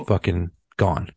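ordinary delivery

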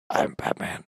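breathless whispered growl; whispered Batman voice. Retold or imitated. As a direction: whisper it like a brooding hero. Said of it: "Just him being like".

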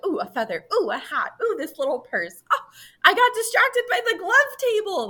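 giddy rising oohs at each item; breathlessly distracted delight, building up; excited. Imitating a distracted shopping character.

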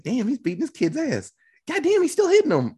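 high, incredulous mock outcry; retold appalled disbelief. Reacting to Mel beating Ricky, appalled. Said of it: "I was like".

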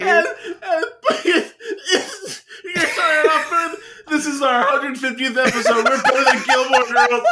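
high, laughing through words; can barely speak for laughing. Real, not acted. Still laughing hard.

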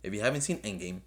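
usual speaking voice

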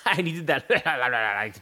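speaking through laughter; laughing. Real, not acted. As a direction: chuckle while telling it.

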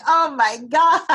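a hint of horrified surprise on arrival; dismayed. Arriving at the psych respite.

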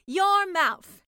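proud announcement. Acted. Mermaid proudly announces answer.

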